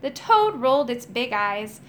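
ordinary delivery, nothing marked

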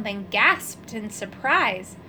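ordinary delivery, nothing marked

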